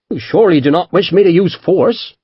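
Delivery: high, courteous Devonshire menace; polite threat. A narrator's reading. Captain politely warning the merchant, Devonshire accent. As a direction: threaten with a smile.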